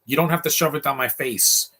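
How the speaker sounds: ordinary talk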